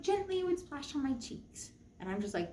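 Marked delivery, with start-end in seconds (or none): none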